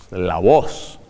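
very low, slow-sinking deep voice; deep male voice, pitched low. Shown as a demonstration.

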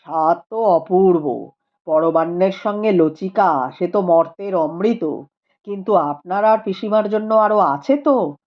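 a calm scholar speaking gravely; Rupendra's voice. A narrator's reading. (নিচু গম্ভীর গলায় পণ্ডিত রূপেন্দ্র)

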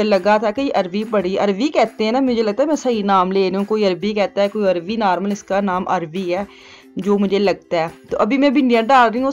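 normal talking voice

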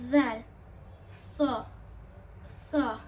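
Zel and so with the tongue between the teeth, a th sound; tongue out between the front teeth on Zel and so; lisped letters. Shown as a demonstration. Teacher repeating the lisped letters for students. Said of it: "böyle diliniz geliyor".

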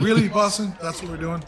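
high, loud incredulous question; retold disbelief. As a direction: act out being appalled at the crowd. Retold disbelief at the crowd's chant.

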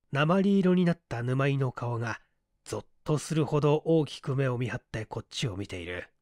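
ordinary delivery

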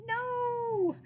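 high, loud, drawn-out wail of refusal; long exasperated cry; groan. A narrator's reading. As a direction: groan it as a despairing mom. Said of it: "my mom groaned".

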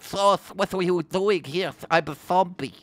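high mock Madrid accent with a heavy lisp; lisped comic Madrid Spanish accent. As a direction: fake a Madrid accent, lisping into th. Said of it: "we have to do a Madrid accent".

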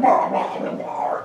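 slobbering cartoon beast noises; messy monster gobbling. Tsar eating among dainty European guests, messy monster gobbling. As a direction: gobble like Slimer at the table. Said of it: "Beauty and the Beast or Slimer".

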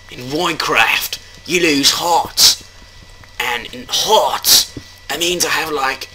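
high, hammed-up Australian wildlife-host accent; bad Australian accent. Player narrating Minecraft danger, bad Australian accent. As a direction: exclaim in a mock Australian accent, stretching the words. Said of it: "I'm not really good with my accents".

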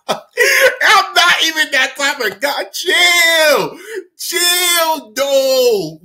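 high, drawn-out squeal; laughing protest. Real, not acted. Storyteller denies being that guy, laughing protest. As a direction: beg them to chill through laughter.

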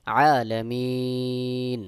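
আলামিন very slow, final ee held extra long into the n; three-alif stretch. Shown as a demonstration. Teacher shows correct stopping at verse end, long-held আলামিন. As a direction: chant আলামিন, stretching its last vowel three beats. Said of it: "তিন আলিফ টান দিয়ে পড়তে হবে".

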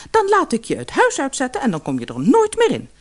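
high, sharp angry threat; wife scolding the fisherman angrily. Acted. Angry wife threatens her husband, high and sharp. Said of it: "zei de vrouw boos".

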